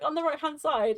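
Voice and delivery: high, loud re-enactment of giving directions; flustered retelling. Retold or imitated. Retells flustered attempt to give directions.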